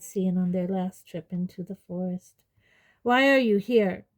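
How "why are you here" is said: loud, higher challenge; bellowed demand. A narrator's reading. Centaur confronting intruders, loud demand. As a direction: demand an answer in a raised voice. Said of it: "bellowed the hard-faced gray centaur".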